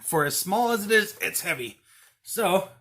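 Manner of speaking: straining while lifting; effort grunt. Real, not acted. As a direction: talk through the strain of a heavy lift. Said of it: "excuse me if I'm kinda grunting 'cause it's a little heavy".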